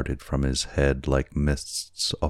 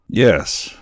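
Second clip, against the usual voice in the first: put-on eager drunken yes; 7 semitones higher, 7 dB louder than usual. A narrator's reading. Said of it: "said Kiawe".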